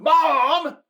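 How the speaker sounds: high, loud mock yell of protest; exasperated yell. Daughter protesting mom's dinner talk, exasperated high yell. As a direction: imitate a mortified daughter yelling. Daughter shouts at embarrassing mother.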